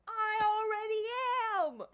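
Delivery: high, loud, slow defiant shout; defiant outburst. Real, not acted. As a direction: fling it back at the critics. Defiant reply to critics.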